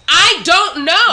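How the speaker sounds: loud, high, drawn-out protest; mock-despairing yell; exasperated outburst. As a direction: blurt it out slowly in comic helplessness. Acts exasperated answer to "why.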